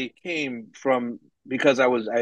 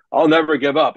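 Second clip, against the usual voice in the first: mock stubborn vow, higher and louder, NEVER pushed; 6 semitones higher, 6 dB louder than usual. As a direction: ham up a pig-headed "I'll never give up". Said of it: "be stubborn as hell and go".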